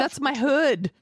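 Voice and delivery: high, drawn-out mock boast; imitated hometown pride. Teasing the friend who saw their corner, high mock boast. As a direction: draw it out high, proudly. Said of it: "You're all".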